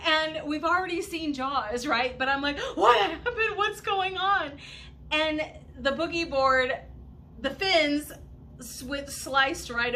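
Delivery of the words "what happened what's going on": high, loud frantic questions; calling to a hurt friend in fright; panicked asking. Retold or imitated. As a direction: ask in a rising panic. Friend surfaces screaming.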